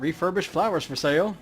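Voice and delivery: lightly voiced vendor; market vendor call. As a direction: call out to passing shoppers.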